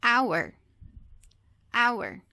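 "Hour" starting on the vowel, H left silent. Shown as a demonstration. Teacher shows the English exception, silent H in "Hour". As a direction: say "Hour" with no H sound at all. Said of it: "a gente não pronuncia o H".

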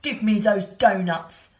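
loud, greedy pig. A narrator's reading. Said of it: "said the pig".

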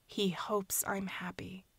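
breathless whispered echo, fading away. Acted. Niece echoing the man's song to herself, whispered. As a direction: let it die to a whisper.

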